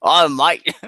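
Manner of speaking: mock Aussie "mate" on request; Australian accent. Shown as a demonstration. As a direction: repeat the Australian impression for laughs. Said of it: "do that one more time".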